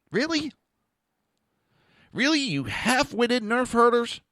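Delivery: high, slow mocking disbelief; incredulous scorn. Real, not acted. Ranting at opponents, high incredulous scorn. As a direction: sneer the really up high.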